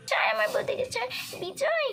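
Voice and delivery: high-pitched distressed cries; panicked. Acted. Girl seeing her sister-in-law attacked, high panicked cries. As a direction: cry out high in horror.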